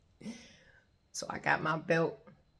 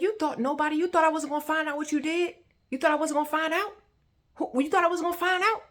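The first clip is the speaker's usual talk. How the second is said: high, raised confronting questions; intense rage; 10 semitones higher, 6 dB louder than usual. Retold or imitated. Confronting him over what he did, intense rage. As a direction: press the accusation, pitched up and louder. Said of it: "calm, a calm rage".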